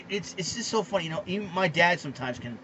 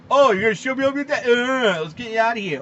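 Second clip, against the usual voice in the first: louder, quick mocking umpire voice; mocking petty umpire; 3 semitones higher, 6 dB louder than usual. Ranting host mocks the umpire who ejected Beltre, in a sneering voice. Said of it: "has decided".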